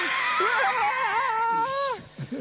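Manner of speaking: high, loud drawn-out fake sob; mock wailing. Imitating the boy's crying mother, loud mock wail. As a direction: bawl in exaggerated fashion.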